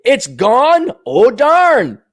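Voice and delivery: high, loud, fake surprise; hammed-up oh-darn; mock dismay. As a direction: act shocked about Minnesota.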